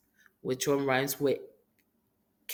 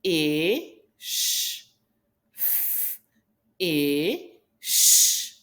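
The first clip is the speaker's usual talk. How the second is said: slow, stretched fish; slow sounding out. Shown as a demonstration. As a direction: stretch the word slowly. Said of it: "Let's say the sound slowly".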